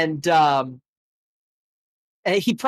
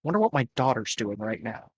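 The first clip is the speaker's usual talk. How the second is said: softer, musing to himself; 达蒙走神自言自语，轻声; distracted Damon; 4 semitones lower, 7 dB quieter than usual. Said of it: "Damon is just like".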